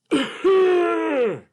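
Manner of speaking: a high, loud, hammed-up cough; cartoon cough. Puppet coughs out its morning breath, high and loud.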